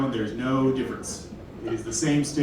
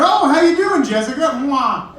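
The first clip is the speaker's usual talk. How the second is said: much higher, louder flirty greeting; mock smooth greeter; 10 semitones higher, 5 dB louder than usual. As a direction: ham up a cheek-kissing hello. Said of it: "you're like".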